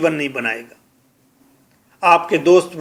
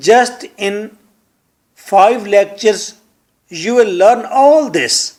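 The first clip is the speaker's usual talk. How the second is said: slow emphatic; 2 semitones higher, much slower than usual. Shown as a demonstration.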